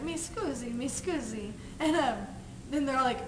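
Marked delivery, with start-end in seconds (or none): 0.0-1.5 soft mock Italian apology; Italian accent. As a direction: murmur it like an unbothered Italian stranger. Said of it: "Italian guy walks in".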